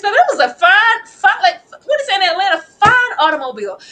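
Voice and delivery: high, loud gushing; loud rave. Real, not acted. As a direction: rave about that burger. Raving about the burger.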